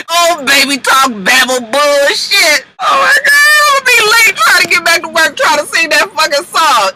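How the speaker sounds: hammed-up wailing, high and loud; fake-sob shouting the complaint; mock crying.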